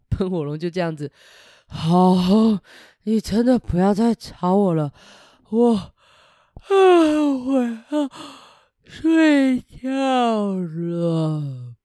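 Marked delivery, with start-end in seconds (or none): 1.7-11.7 drowsy dragon drifting off; sleepy dragon. Acted. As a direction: fade out into sleep. (想睡觉的喷火龙)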